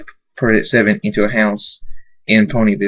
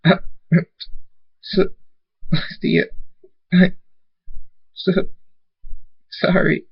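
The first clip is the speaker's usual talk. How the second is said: high, halting sobbed apology; crying; 9 semitones higher, 3 dB quieter, slower than usual. Acted. Thunderstorm apologizing to his dead family, crying haltingly. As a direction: break the words up with sobs. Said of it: "falls to his knees crying and says".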